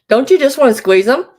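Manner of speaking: mock-fierce gush. Real, not acted. Grandma jokes to viewers about squeezing cute babies, loud playful squeal. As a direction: pretend to be overcome by the cuteness. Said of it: "No, I'm just kidding".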